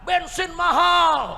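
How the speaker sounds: imitating a rider's high, long-drawn yell; protesting shout. Ojek driver protesting fuel prices, shouting. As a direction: cry out the complaint loudly and slowly. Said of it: "Nah, tukang ojek protes".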